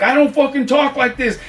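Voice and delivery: very high-pitched mock outrage; so pissed. Narrator arguing back at the script writer, acted anger. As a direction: imitate a worked-up, indignant refusal. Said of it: "He was so fucking pissed".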